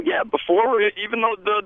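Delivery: normal talking voice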